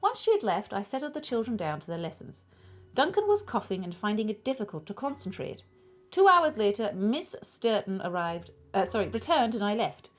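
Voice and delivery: put-on a loose, half-Irish accent for the witness; Irish-ish accent. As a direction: read Edgar's testimony in a vague Irish lilt. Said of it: "What accent does he have?".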